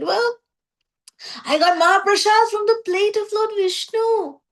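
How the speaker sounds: high, excited voice announcing his rare luck; excited Narada. Retold or imitated. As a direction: pitch it up, eager to share the news. Narada boasts of rare prasad.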